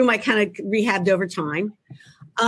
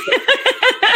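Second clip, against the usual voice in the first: high falling laugh; 14 semitones higher than usual. Real, not acted. Author laughing at her own quip about middles, high falling laugh.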